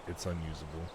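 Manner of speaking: normal talking voice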